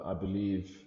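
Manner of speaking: ordinary delivery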